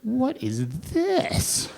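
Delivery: slow, high, drawn-out puzzled question; mocking what. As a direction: stretch it out, baffled and amused. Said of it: "they're like".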